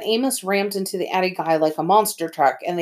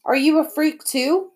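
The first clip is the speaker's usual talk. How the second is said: sneering taunt; 9 semitones higher than usual. Acted. Eddie taunts Amos.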